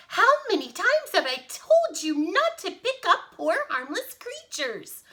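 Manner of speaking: high, loud motherly telling-off; hammed-up high-pitched scolding; scolding yell. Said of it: "she yelled".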